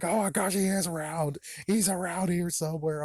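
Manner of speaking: high-pitched mock panic; hammed-up high alarm; freaking out. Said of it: "freak out even more".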